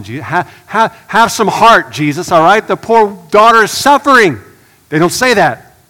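loud, high, driving sermon voice; rising to full preaching force. Acted. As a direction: preach it hard to the congregation.

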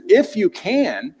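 slow, incredulous "If you can."; Jesus repeating the father's words with incredulity. Retold or imitated. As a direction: echo it back in disbelief. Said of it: "with this sort of incre-, incredulity".

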